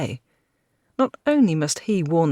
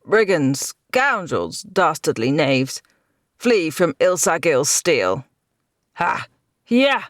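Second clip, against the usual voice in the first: boastful battle taunts; 4 dB louder, slower than usual. A narrator's reading. Knight taunting enemies mid-fight.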